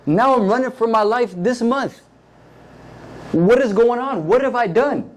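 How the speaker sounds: a hunted man who can't believe it; panicked David. Acted. David pouring out his fear to Jonathan, panicked and high.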